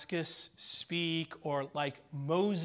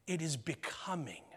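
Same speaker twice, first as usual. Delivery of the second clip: whisper; 3 semitones lower, 8 dB quieter than usual. Acted. Repeating key line as whisper.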